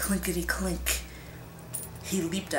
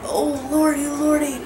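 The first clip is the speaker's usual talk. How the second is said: high, loud frightened cry; terrified cry; 10 semitones higher, 8 dB louder than usual. A narrator's reading. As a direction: cry out in terror, shaking. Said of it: "shook and cried".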